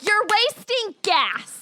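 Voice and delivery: mock scolding. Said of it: "Be like".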